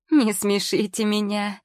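giggling it off; merrier laughter. A narrator's reading. Pregnant girl laughing at the witch's dragon joke. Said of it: "сильнее развеселилась девушка".

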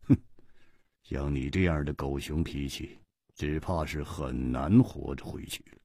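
low, soft, icy jab; coldly. Acted. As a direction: say it coldly and quietly. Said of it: "冷冷地说". (姬冰燕冷冷地说)

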